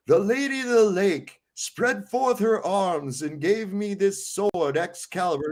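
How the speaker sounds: King Arthur declaring, hammed-up grandeur; grand royal proclamation. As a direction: proclaim like a pompous monarch. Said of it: "he says".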